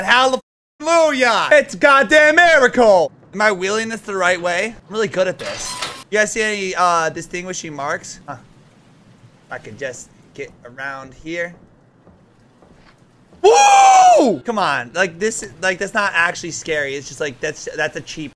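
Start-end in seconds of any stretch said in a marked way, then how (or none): 0.0-3.1 sarcastic hallelujah. Real, not acted. Lights come back on. 13.4-14.4 very high loud whoop of fright; startled shriek. Real, not acted. Startled by a cheap scare.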